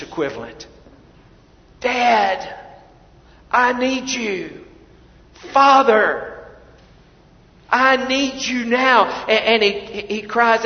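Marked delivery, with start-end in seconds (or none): 1.8-9.0 higher, slow pleading cry; pleading prayer; anguished cry. Acted. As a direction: beg your father from your knees. Jesus crying out in Gethsemane.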